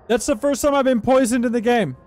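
high, loud disbelief; real high-pitched surprise; startled outburst. Real, not acted. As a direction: exclaim it, caught off guard by the game. Startled by being poisoned in game.

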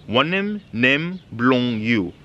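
drawn out word by word; spaced-out language lesson pronunciation; slow teaching demonstration. Shown as a demonstration. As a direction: model the phrase very slowly for learners. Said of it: "teaching you some basics".

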